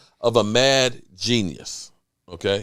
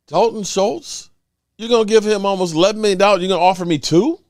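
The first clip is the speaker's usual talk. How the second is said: mock outrage; 8 semitones higher than usual. Voicing mad Kearse.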